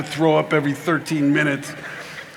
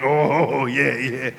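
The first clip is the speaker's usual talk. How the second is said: low mock-preacher moan; low, rumbling encouragement; preacher working himself up; 5 semitones lower, much slower than usual. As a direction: lightly imitate a preacher warming up the room. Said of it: "he's working himself up".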